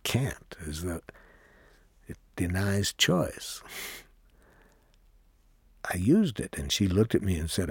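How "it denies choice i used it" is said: ordinary talk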